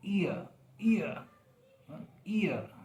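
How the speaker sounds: ईयर drawn out high and slow, ee gliding to uh; slow diphthong demo. Shown as a demonstration. Teacher recapping the sound for students, slow and high. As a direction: draw ईयर out slowly to show the glide. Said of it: "तो ये sound हुआ".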